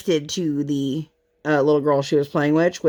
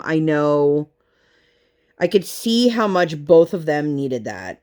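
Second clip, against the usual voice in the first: usual speaking voice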